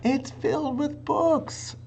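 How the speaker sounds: put-on high, slow delighted girl voice; Annie thrilled. A narrator's reading. Annie tells Jack about the books, high, slow, delighted. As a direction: savour the wonder. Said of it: "said Annie".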